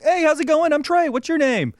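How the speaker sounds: friendly greeting. Retold or imitated. Dad introducing himself to a random guy at the park, friendly greeting. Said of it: "just be like".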